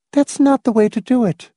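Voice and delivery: high-pitched voice for a woman character; Miss Rice's voice. Acted. Miss Rice correcting Hamilton on the plan, high character voice.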